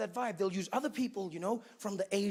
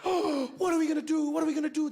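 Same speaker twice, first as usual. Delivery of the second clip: higher, louder mock panic; flustered delegates; 6 semitones higher, 4 dB louder than usual. United Nations delegates panicking over the bomb threat, mock panic. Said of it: "they'd be like".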